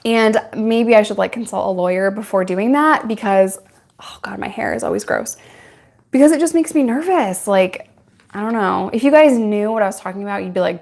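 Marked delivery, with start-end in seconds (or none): 4.0-5.4 real whispered complaint to herself; whispered aside. Real, not acted. Catching her hair mid-vlog, whispered self-aside. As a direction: breathe it under your breath.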